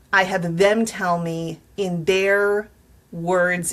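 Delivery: ordinary delivery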